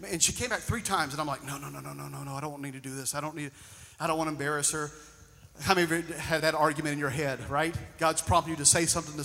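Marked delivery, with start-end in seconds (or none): 1.4-4.9 much softer, hushed self-talk of refusal; inner reluctance. Retold or imitated. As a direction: talk yourself out of it under your breath. Inner argument with God.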